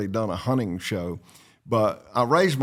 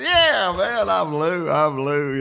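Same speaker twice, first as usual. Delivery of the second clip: much higher, louder, playfully eager; hammed-up eager reply; mock eager; 8 semitones higher, 3 dB louder, slower than usual. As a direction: play along as Lou, hamming it up. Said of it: "I said".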